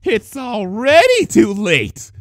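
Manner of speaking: loud, slow high villain crow; gloating villain crow. Acted. False Rarity gloating as she rises from the bunnies. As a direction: crow it triumphantly. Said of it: "crowed the false Rarity".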